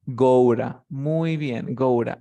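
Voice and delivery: "Goura" with the t as a tap r; linked go to. Shown as a demonstration. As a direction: blend "go to" into "goura". ("go to" unido como "goura")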